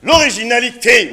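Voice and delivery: usual speaking voice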